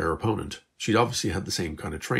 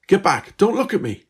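high, loud frightened pleading; girl panicking as a stranger grabs her, high and loud; panicked; 12 semitones higher, 8 dB louder than usual. Acted. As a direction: back away in panic. Stranger panics when grabbed.

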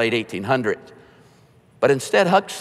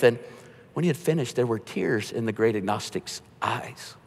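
normal talking voice

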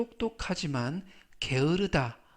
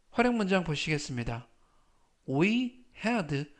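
normal talking voice

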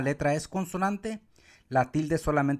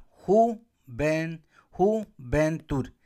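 "juventud" slowly, broken as ju, ven, tud; split into syllables; much slower than usual. Shown as a demonstration. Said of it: "Tres sílabas".

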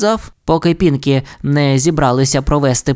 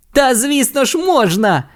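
much higher, insistent; mock shout; 9 semitones higher than usual. A narrator's reading. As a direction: protest like a child. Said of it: "крикнув він".

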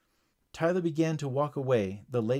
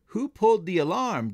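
raised, louder questioning; demanding; 6 semitones higher, 5 dB louder than usual. A narrator's reading. Security guard confronts the crowd over the alarm, demanding. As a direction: demand an answer. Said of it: "demanded the security guard".

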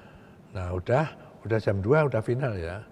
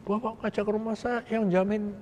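pitched up, softer, doubting; suspicious rebel; 9 semitones higher, 4 dB quieter than usual. Retold or imitated. Rebel questions the official's safety offer, higher and softer. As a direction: voice the rebel's anxious distrust, high.

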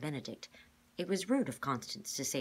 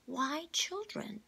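puzzled question; 12 semitones higher, much slower than usual. A narrator's reading. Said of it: "Sticky said".

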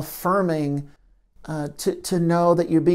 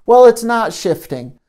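louder, frustrated outburst; angry hurting part; 4 semitones higher, 8 dB louder than usual. Shown as a demonstration. Hurting part frustrated with the observer self, louder. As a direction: snap back in frustration. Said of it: "I'm the part that's hurting".

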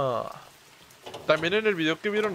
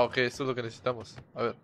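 usual speaking voice

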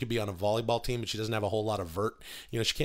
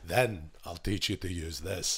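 slow, lowered movie-mentor impression; Uncle Angus from Braveheart; 6 semitones lower, 4 dB quieter than usual. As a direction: drop the pitch and draw out the lesson. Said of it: "That would have made Braveheart".

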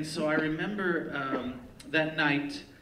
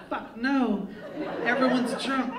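higher-pitched, indignant retold refusal; emphatic refusal; 9 semitones higher, 4 dB louder than usual. Comic turning down the bar owner's request, emphatic refusal. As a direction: push it up high as you refuse.

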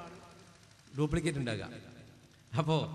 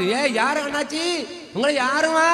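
much higher, loud, slow and strange; mock-mad squeal; 8 semitones higher, 8 dB louder, slower than usual. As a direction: sing it out like she doesn't know them. Feigned madness to mother. (ഉയർന്ന ശബ്ദത്തിൽ ഭ്രാന്ത് അഭിനയം)

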